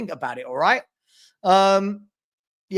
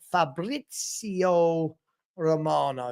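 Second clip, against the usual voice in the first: drawn-out name; 2 semitones lower, 4 dB quieter, much slower than usual. Real, not acted. Announcing the transfer reporter.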